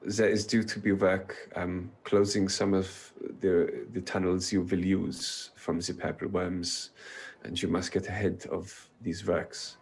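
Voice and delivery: a light German Matron Mother; German accent. Acted. Matron Mother warns the party about the worms, in a German accent. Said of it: "butchering the accent".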